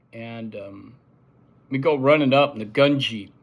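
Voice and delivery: ordinary talk, nothing marked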